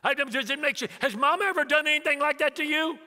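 high, loud mock indignation; indignant son. Son asks dad about Mom, high and indignant. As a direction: demand an answer as an aggrieved son.

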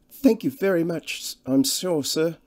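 high-pitched, deferential countrywoman; humbly fretful. A narrator's reading. Cottage woman thanking the traveller for his help, humbly fretful. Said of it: "She spoke in a humbly fretful voice".

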